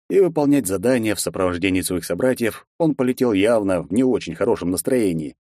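plain reading voice

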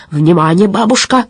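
usual speaking voice

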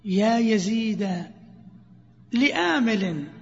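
slow drawn-out verse line; recited verse. Shown as a demonstration. Teacher reciting the verse to students, slowly. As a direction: intone slowly like a poem.

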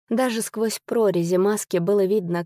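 ordinary delivery

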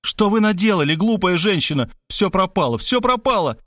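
high-pitched cry of despair; frantic, accusing, pitch climbing; panicked wail. A narrator's reading. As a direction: wail it as if all is lost. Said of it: "завопил я".

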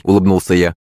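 ordinary delivery

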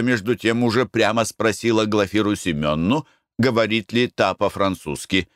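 usual speaking voice

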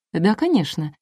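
ordinary delivery, nothing marked

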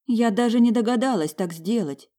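high, moaning self-reproach. A narrator's reading. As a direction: moan it out, blaming yourself. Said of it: "простонала она".

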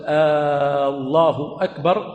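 long held "آ" opening "الله", then "أكبر"; slow, stretched "آالله" at the start; lengthened hamza. Shown as a demonstration. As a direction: drag out the first vowel of "الله" in "الله أكبر". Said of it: "فلما تمد ماذا؟".

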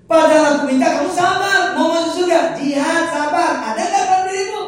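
high, loud, laughing refusal; loud verdict. Acted. Preacher voicing God denying heaven to the impatient, loud laughing verdict. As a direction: declare it loudly, half laughing.

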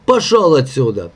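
usual speaking voice

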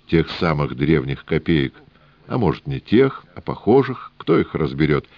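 usual speaking voice

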